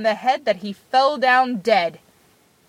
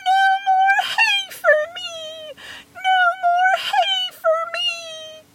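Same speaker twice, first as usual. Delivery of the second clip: tiny, very high squeal of a voice; crying out. A narrator's reading. Tom Thumb crying out from inside the cow, very high-pitched. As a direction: cry out in a piping thumb-sized voice. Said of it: "cried out again".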